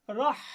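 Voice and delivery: Arabic throat h demo; pressed throat h. Shown as a demonstration. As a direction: push the h of রাহ from a tight throat. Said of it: "আমার গলাটা কিন্তু চেপে যাচ্ছে".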